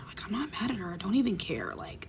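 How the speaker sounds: soft whisper. Real, not acted. Telling viewers she forgives her, whispering. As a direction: let it fall to a hush.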